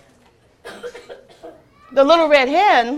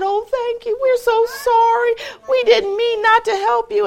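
high apologetic animal voices; sorry friends; 10 semitones higher than usual. Acted. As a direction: plead like sorry little friends. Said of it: "And they said".

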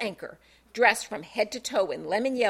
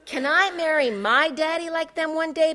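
put-on higher, innocent little girl; innocent child; 6 semitones higher, 3 dB louder than usual. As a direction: ask innocently like a small child. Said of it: "Bella asked innocently".

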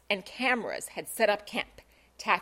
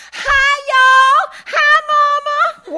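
much higher and louder, slow squealing greeting; giddy screech; 13 dB louder, much slower than usual. Acted. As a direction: squeal into the camera at mom. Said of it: "one girl screeched giddily".

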